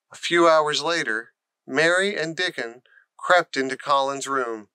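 usual speaking voice

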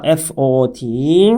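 slow letter-by-letter spelling; slow teacher spelling. Shown as a demonstration. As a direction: spell out P E E L one letter at a time. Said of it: "spelling the word out".

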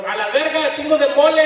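high, excited shout; friend exclaiming. Retold or imitated. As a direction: cry out at the sight of blood. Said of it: "my other friend goes".

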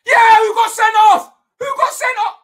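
rising into a high, excited shriek; gloating shout. Real, not acted. Fan gloating over a sending-off, excited shouting.

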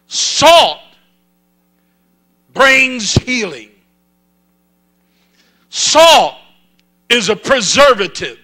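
loud, high, emphatic revival preaching shout; full-force preaching. Real, not acted. Preacher urging the church toward revival, full-force shout. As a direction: preach it out in forceful bursts with long pauses.

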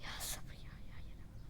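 breathy whisper, barely audible; whispered plea for patience. Real, not acted. Gamer whispering to himself for patience. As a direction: whisper it through clenched teeth. Praying for patience while gaming.

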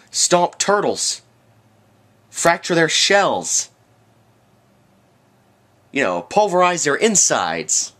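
high, slow mocking sarcasm; sarcastic. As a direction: drag it out with scorn. Sarcastic mock outrage.

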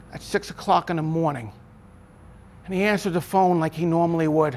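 ordinary delivery

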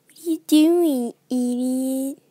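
loud exasperated yell at the screen; frustrated loud insult; angry shout. Real, not acted. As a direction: snap at the character. Shouting at animatronic.

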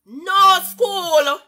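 loud exclamation. Real, not acted. Streamer exclaims to her live viewers, loud and high. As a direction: blurt it out in disbelief.

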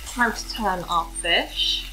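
normal talking voice

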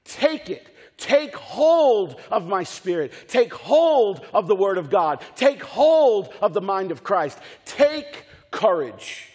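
loud, slow exhortation in repeated waves; emphatic pulpit charge; forceful preaching. Acted. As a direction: drive home each command, stretching the key word.

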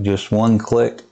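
ordinary talk, nothing marked

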